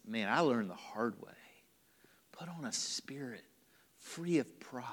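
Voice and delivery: soft unvoiced whisper; humbled whisper. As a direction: confess it in a hush, chastened. Humbled Peter confessing.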